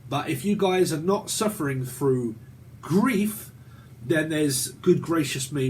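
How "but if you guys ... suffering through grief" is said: ordinary talk, nothing marked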